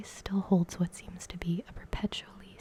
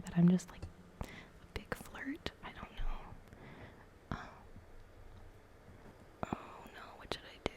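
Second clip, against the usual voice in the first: breathy whispered chat, close to mic; whispering. Real, not acted. Whispers throughout the video.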